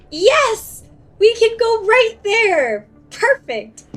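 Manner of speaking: high, loud triumphant yell of yes; excited shout. Real, not acted. Player finding the route in the game, excited loud cheer.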